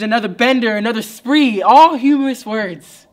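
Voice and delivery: put-on the drunk in him egging on, growing to a roar; drunk's urging voice. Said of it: "the drunk always says to the writer".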